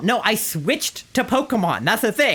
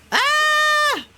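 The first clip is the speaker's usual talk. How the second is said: long, very high-pitched scream; panicked scream; 3 dB louder than usual. Real, not acted. Player escaping an explosion, panicked scream.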